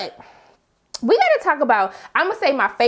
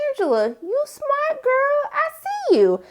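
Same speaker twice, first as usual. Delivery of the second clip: put-on high, slow hype-girl praise; much higher, drawn-out "I see you" approval; sassy praise; 13 semitones higher, slower than usual. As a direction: hype her up like a proud bestie. Praising Angela's sim card trick.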